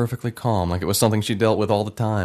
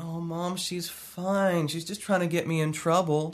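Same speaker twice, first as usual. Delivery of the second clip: put-on high, whiny kid voice; young boy; 9 semitones higher, 5 dB quieter, slower than usual. Boy [name] answering his mother, whiny and high. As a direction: play the boy brushing it off. Child self voice.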